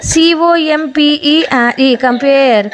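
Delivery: measured dictation pace for learners; unhurried classroom spelling read-out; slow spelling drill. Shown as a demonstration. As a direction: spell each letter slowly, then the word. Slow spelling drill of ear words.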